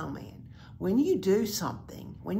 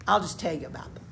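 plain reading voice

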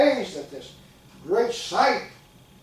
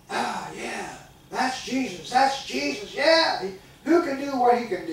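rising, ever higher wonder-struck voices; mock-amazed churchgoers crying out, getting louder; awed crowd; 7 semitones higher, 4 dB louder than usual. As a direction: gush like a fooled believer. Deceived people hailing false Christ.

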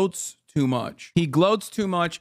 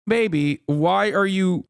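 usual speaking voice

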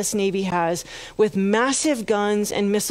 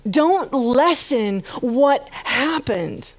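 impassioned preaching; 4 semitones higher than usual. Acted. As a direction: rouse the congregation with conviction. Preaching climax to congregation.

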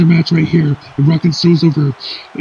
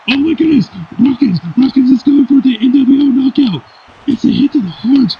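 excited commentary; 8 semitones higher than usual. Acted. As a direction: build the crowd up on the big move. Commentator hyped by finishing move.

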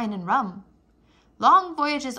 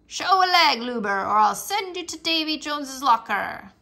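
pirate voice; 3 semitones higher, 4 dB louder than usual. Acted. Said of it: "Talk Like a Pirate".

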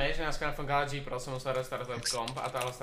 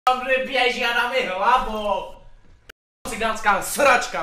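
loud, higher, slow ranting; angry rant; 7 semitones higher, 9 dB louder than usual. Real, not acted. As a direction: complain in frustrated rage. Complaining after being killed.

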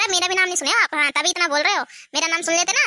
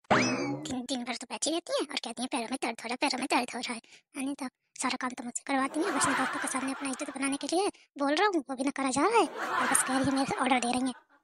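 much softer muttered complaint, half laughing; grumbling under breath; 4 semitones lower, 9 dB quieter, faster than usual. Acted. Son grumbles about mom under his breath, quiet muttering. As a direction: grumble so mom won't hear.

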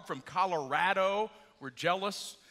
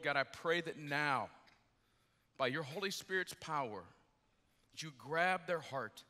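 lower, softer spoken prayer with pauses; 4 semitones lower, 4 dB quieter than usual. Real, not acted. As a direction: pray quietly over the congregation. Leads congregation in prayer.

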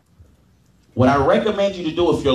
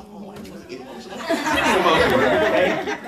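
laughing through emotion; 5 semitones higher than usual. Real, not acted. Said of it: "Now I'm getting emotional".

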